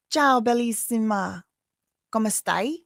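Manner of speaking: light Italian accent, slow and showy; mock Italian greeting. Shown as a demonstration. Showing viewers her Italian in a light Italian accent. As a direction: show off a playful Italian accent. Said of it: "That's how you say that".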